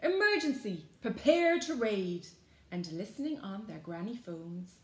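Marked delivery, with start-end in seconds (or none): 0.0-2.3 loud call to arms, starting high; rallying cry. A narrator's reading. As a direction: summon the gang with urgency. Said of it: "cried Mavis Jones".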